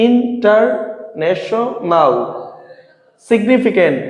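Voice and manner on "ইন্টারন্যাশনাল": "ইন্টারন্যাশনাল" slowly as in, tar, nesho, nal; split into syllables. Shown as a demonstration. Teacher giving a polysyllable example, "ইন্টারন্যাশনাল" slowly split into parts. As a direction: pause between parts of "ইন্টারন্যাশনাল". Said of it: "তিনের অধিক অংশ থাকে".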